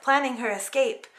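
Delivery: ordinary delivery, nothing marked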